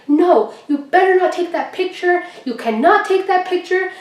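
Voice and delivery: guard lady yelling at the WWE official, mock yell; retold yelling. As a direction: imitate the guard lady shouting. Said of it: "the lady starts yelling".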